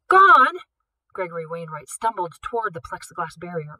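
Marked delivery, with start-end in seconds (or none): 0.1-0.6 much higher, louder disbelieving cry; shocked outcry. Acted. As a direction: yelp it in horror.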